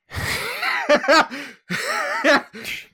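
real high-pitched laughing fit; high giggling fit. Real, not acted. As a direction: crack up helplessly mid-performance. Cracks up trying the accent.

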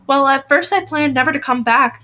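plain reading voice

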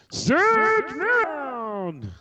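high booming announcer voice; game show announcer. Retold or imitated. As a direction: call it like a game show host. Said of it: "goddamn voiceover thing".

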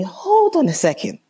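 high-pitched indignant interruption. Retold or imitated. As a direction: cut in, taken aback and high. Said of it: "This is me".

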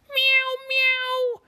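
very high squeaky meows; cat meow. A narrator's reading. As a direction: mew like a little kitten. Kitty cats say meow.

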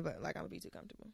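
ordinary talk, nothing marked

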